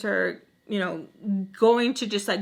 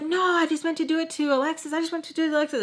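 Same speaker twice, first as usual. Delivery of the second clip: higher-pitched pleading excuse; imitated protest; 6 semitones higher, faster than usual. Woman excusing herself as he grabs her, high protest.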